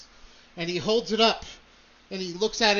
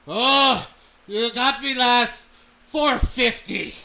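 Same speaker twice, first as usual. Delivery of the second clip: gruff dwarf bidder; 3 semitones higher, 8 dB louder, slower than usual. Acted. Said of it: "he goes".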